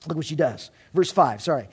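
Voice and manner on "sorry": usual speaking voice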